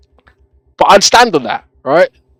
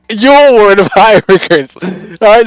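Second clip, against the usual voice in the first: mocking laugh; 5 semitones higher, 5 dB louder than usual. Repeats line, laughing at the viewer.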